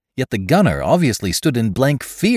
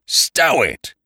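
clipped, scornful order; curt contempt; 7 semitones higher, 4 dB louder than usual. A narrator's reading. New voice silencing the gunner, curtly scornful. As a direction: cut him off with contempt. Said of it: "commanded a new voice, curtly and with irritated contempt".

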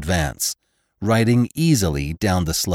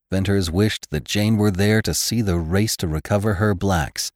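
usual speaking voice

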